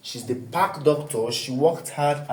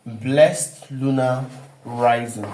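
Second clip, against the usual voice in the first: each word of "Blessed Lunar Rising" drawn out slowly; slow and deliberate, for dictation; slow, spelled-out title; 2 semitones lower, much slower than usual. Shown as a demonstration. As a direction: lay the title down word by word, much slower. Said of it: "we have what we call Blessed Lunar Rising".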